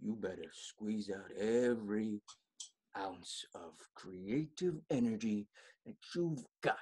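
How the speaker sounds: ordinary delivery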